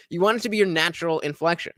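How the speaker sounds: ordinary talk, nothing marked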